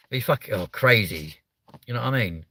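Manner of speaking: ordinary talk, nothing marked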